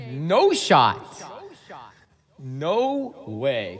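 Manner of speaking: usual speaking voice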